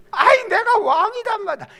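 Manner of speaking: high, loud crying plea; frantic protest. Acted. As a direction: insist desperately through tears. King protests to soldiers.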